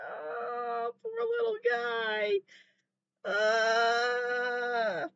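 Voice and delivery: a high, soft whimper over the poor animal; near tears. Real, not acted. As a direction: let the voice crack as if about to cry. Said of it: "I'm gonna fucking cry".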